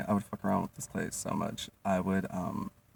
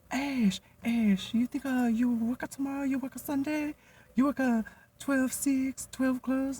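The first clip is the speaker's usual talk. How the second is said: much higher squeaky boss voice, held; mimicking boss Jenny; slower than usual. Imitating the restaurant boss asking her to work Sunday. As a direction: pitch way up to play the boss.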